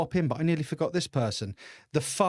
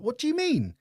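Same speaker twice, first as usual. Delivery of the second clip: baffled question; 10 semitones higher than usual. Retold or imitated. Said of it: "And I'm like".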